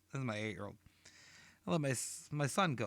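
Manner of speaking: ordinary delivery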